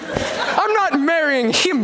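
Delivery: slow laughing scoff, pitched high; laughing refusal. Acted. Bride laughing off the groom, slow and high.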